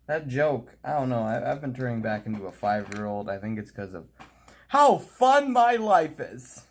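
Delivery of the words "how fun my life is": high, loud, slow ironic sing-song; mock grand voice. Joking about his life, in a high mock-grand voice. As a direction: oversell it sarcastically. Sarcastic silly voice.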